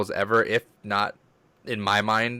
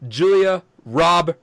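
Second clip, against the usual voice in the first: dramatic name; 8 semitones higher, 6 dB louder, much slower than usual. Retold or imitated. Recalling fake name, dramatic.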